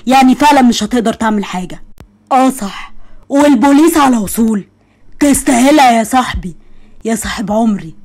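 ordinary delivery

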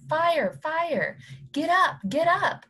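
high, loud alarmed shout. A narrator's reading. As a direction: yell it out in alarm. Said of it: "Papa was shaking me and shouting".

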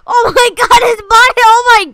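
shrieking high and loud; panicked scream; screaming in horror. Real, not acted. Sees body, screams.

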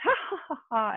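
high-pitched mock laughing; imitated laugh. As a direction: mimic someone's laugh in a high voice. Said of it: "And she laughed".

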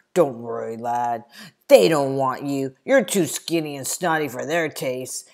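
loud, teasing bully voice; a big lad's loud jeer; booming taunt. Acted. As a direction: lean in and mock him loudly. Big Henry taunts the boy.

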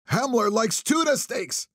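blurted high shout from a rag pile; drunk man's shout. A narrator's reading. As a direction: yell it at a stranger in the dark. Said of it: "he shouted at me".

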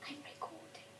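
soft breathy whisper. Real, not acted. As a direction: whisper it under your breath. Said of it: "Sorry for the whispering".

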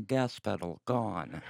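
slow, soft robot announcement; deadpan car computer. Acted. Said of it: "then I go".

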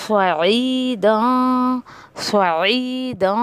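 very slow "फ़ईदा" with a hidden nasal; slow ikhfa. Shown as a demonstration. Quran teacher demonstrating the ikhfa, slowly. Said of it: "तो इख्फा आप करेंगे".

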